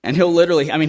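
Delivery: ordinary talk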